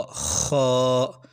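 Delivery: खौ with a scraping back-of-throat kh; raspy kho. Shown as a demonstration. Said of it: "ये खौ जहाँ से हा निकलता है".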